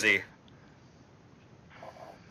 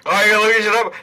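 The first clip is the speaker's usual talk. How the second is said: mocking the GM; 8 semitones higher, 6 dB louder than usual. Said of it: "can't be like".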